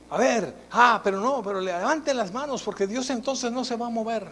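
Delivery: badgering worship-leader impression; pushy worship leader. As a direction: mimic a high, loud praise leader.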